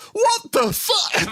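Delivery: very high, slow shocked gasp through laughter; shocked wife. Acted. Wife stepping out, seeing the wooden boy, shocked squeal. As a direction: squeal it in horrified disbelief.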